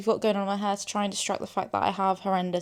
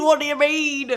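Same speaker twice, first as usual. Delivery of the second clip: higher, louder gasping repeat; even more amazed second time; squealing disbelief; excited disbelief; 7 semitones higher, 8 dB louder than usual. Real, not acted.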